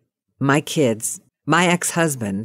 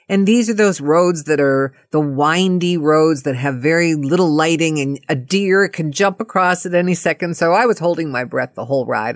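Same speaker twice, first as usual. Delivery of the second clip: plain reading voice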